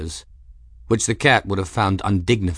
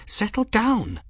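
much higher, hushing command; hissed in cat; 13 semitones higher than usual. A narrator's reading. Young woman calming her furious cat, hissing. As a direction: rein the cat in, high and tense. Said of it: "Bridget hissed in cat".

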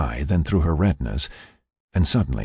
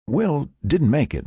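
higher-pitched, breaking the news; Frank; 9 semitones higher than usual. Acted. Frank spares his friend breaking bad news, higher voice. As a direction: step in, pitched up. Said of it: "Frank interrupted".

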